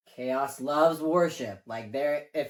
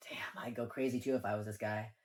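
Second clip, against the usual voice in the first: whispered aside to himself; hushed inner thought; 4 semitones lower, 6 dB quieter than usual. Retold or imitated. Fan whispering sympathy for the tragic traitor. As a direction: breathe it like a private confession.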